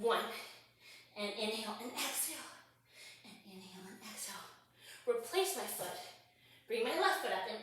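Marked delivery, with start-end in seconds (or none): 3.2-4.3 quick, hushed whisper; very soft, rapid whispered count of breaths; whispered breath cue. Real, not acted. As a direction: whisper it quickly while exerting. Breath cue mid push-ups.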